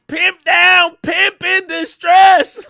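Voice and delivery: hammed-up loud, drawn-out distress call; mock alarm call. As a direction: belt it like a mock emergency alarm. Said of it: "the call I got, that was like".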